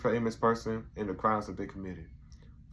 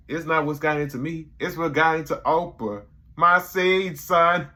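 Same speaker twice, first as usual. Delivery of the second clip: loud, climbing higher with each phrase; proud boast; 7 semitones higher, 8 dB louder than usual. Retold or imitated. Dave quitting his job and bragging, loud and proud. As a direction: brag it, building up. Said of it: "He's like".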